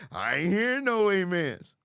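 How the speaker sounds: hammed-up preacher demanding an amen; mock preacher. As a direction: boom it out like a pastor calling on the pews. Repeats preacher call, laughing.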